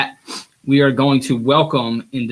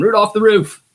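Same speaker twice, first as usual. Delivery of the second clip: put-on higher, louder little kid voice; imitating his son; 9 semitones higher, 7 dB louder than usual. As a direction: chant it like a toddler running around. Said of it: "Running around the house going".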